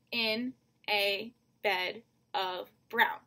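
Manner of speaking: slow, evenly spaced syllables in "in a bed of brown"; "in a bed of brown" at half speed, beat by beat. Shown as a demonstration. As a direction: count out "in a bed of brown" with a gap after each word. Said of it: "you've got that five syllables".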